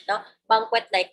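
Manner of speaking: ordinary talk, nothing marked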